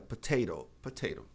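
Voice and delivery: "potato" with the last T as a tapped R; American flapped T. Shown as a demonstration. Teacher showing how Americans usually say it, flapped T. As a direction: keep the first T, tap the second in "potato". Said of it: "substituir o T pelo re".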